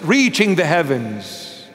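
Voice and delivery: normal talking voice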